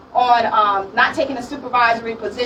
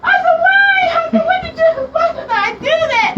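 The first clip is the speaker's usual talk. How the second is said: high, pleading cry to God, half-laughing; mock crying prayer pitched high; retold tearful plea; 14 semitones higher, 3 dB louder than usual. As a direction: wail it up at God like reliving the tears. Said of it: "start crying".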